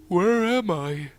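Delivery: slow, drowsy yawn while talking. A narrator's reading. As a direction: speak mid-yawn, just woken. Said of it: "Rocky yawned".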